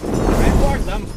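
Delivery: loud, frustrated cry; exasperated shout. Acted. Torch shouts loudly about the pilots who ignored his warning.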